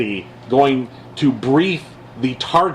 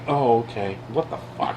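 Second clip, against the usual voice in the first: muttered disbelief; 5 semitones lower, 6 dB quieter than usual. Real, not acted. Disbelief at public forgiving Nunes.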